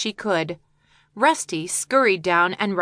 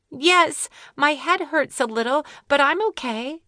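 put-on higher little girl voice; young girl; 7 semitones higher than usual. Jenny reassures the bird, higher young voice. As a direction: answer bravely, a bit hurt.

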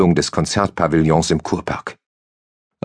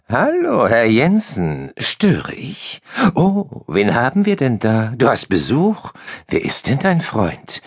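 high, slyly sweet woman's voice; snake smile; 6 semitones higher, slower than usual. A narrator's reading. The woman teasing Jensen about his visitor, sly and sweet. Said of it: "sagte mit einem Schlangenlächeln".

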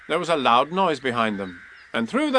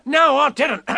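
higher, louder quick denial; protesting Tigger; 13 semitones higher, 5 dB louder, slower than usual. A narrator's reading. Tigger interrupting Rabbit, loud higher protest. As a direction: cut in protesting. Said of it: "said Tigger".

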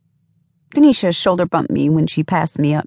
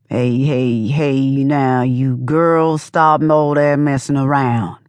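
put-on slow, low old man mumble; drawn-out mumble of an old bus driver; 4 semitones lower, much slower than usual. A narrator's reading. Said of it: "mumbled all slow in his old man voice".

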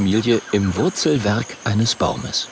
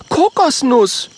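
higher, louder outraged call; indignant call; 4 dB louder, slower than usual. Acted. Mathilda calls out to Kokosnuss, higher indignant voice. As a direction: cry out his name, scandalised. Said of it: "ruft Mathilda empört".

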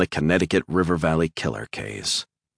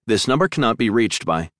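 recorded phone message read flat and a bit higher; mechanical drone; 6 semitones higher than usual. A narrator's reading. Phone line's recorded message, mechanical drone. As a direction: drone it like an automated line. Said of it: "A mechanical voice droned".

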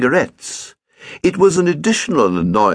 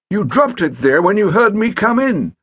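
put-on irritable elderly man, pitched up; fussy stepfather scolding; testy old gentleman; 12 semitones higher, 3 dB louder than usual. Pett accuses.

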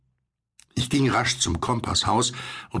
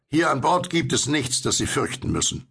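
higher call settling to normal pitch; 6 semitones higher than usual. A narrator's reading. Will reassures the frightened stranger in the boat, calling out. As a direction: call out reassurance, starting high and falling. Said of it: "rief Will".

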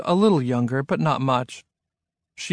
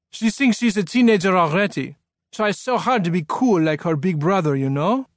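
put-on higher French-accented father; 5 semitones higher than usual. A narrator's reading. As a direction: complain about your child in a French accent. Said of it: "in a French-sounding accent".

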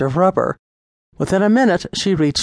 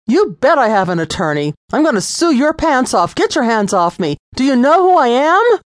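higher, louder furious tirade; outraged shout; 8 semitones higher, 3 dB louder than usual. A narrator's reading. Lisa raging at the arresting officer, loud and furious. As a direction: threaten him with everything.

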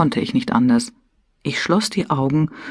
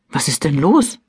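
mildly alarmed question. A narrator's reading. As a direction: ask with sudden concern. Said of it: "fragte ich alarmiert".

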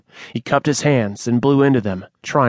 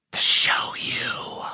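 slow, soft breathy whisper of a snake spirit; shadow's whisper; 6 dB quieter, slower than usual. Acted. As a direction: let it slither out unvoiced and slow. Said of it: "the shadow whispered".

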